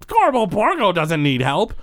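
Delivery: high, drawn-out boastful character voice; proud Gorbo Borgo. Acted. Gorbo proudly refuses help.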